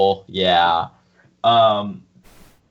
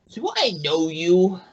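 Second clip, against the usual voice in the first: put-on high, wavering old man; 8 semitones higher than usual. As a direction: play a confused old man, pitched up. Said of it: "The old man is like".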